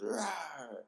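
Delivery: high, soft mock roar; animal roar. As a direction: imitate a kid's little roar. Imitating kid's animal noises.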